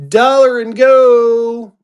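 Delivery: high, loud, stretched holler; slow sing-out to open the bidding; auctioneer's opening call. Real, not acted. Auctioneer calling the opening bid.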